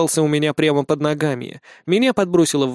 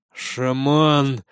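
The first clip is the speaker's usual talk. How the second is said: angry growl. A narrator's reading. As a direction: growl the name with hatred. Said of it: "злобно прорычал Невзоров".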